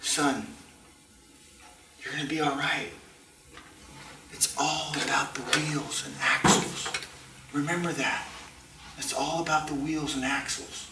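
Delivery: soft, lightly voiced old man; gentle old man. Old man consoling the heartbroken kid, gently. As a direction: quietly reassure the boy.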